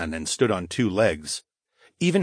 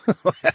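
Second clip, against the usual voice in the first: chuckle; 10 semitones higher, 4 dB louder than usual. Acted.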